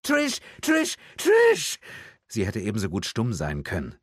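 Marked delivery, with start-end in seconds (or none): 0.0-1.8 very high repeated cries, rising to a scream; escalating high-pitched calls; desperate screaming. A narrator's reading. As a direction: scream your child's name, louder on the last. Mother screams for lost daughter.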